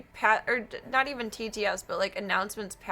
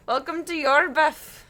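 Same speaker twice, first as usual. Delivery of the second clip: slow, louder Scottish greeting; a Scottish welcome to the channel; Scottish accent; 4 semitones higher, 6 dB louder than usual. Pretend. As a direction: roll it out in a playful Scottish accent. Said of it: "coming out of my mouth Scottish".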